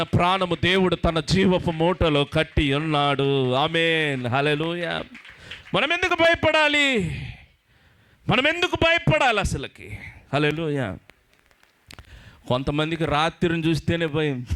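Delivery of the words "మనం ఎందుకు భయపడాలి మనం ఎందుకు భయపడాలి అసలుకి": high, ringing rhetorical question; fervent preacher's challenge; rousing challenge. Acted. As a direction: fling the question at the crowd. Preacher challenges congregation's fear.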